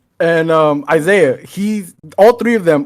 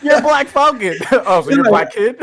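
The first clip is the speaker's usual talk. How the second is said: laughing mock quote; 6 semitones higher than usual. Mocking the cop scene.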